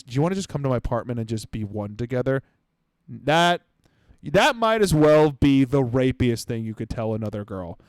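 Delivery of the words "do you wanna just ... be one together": fast, softer, lower creepy pitch; mock seductive line.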